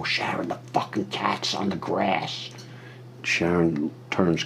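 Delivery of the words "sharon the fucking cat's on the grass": calm low warning. Retold or imitated. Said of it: "I walked up calmly".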